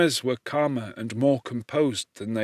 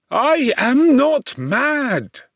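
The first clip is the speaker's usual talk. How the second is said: high, slow, loud outcry; energetic cry; 13 semitones higher, 6 dB louder, much slower than usual. A narrator's reading. Victor protests to his father, loud and high. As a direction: cry it out, desperate to be believed. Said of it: "I cried energetically".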